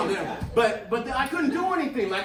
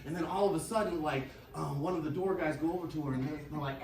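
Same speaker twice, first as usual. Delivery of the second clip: normal talking voice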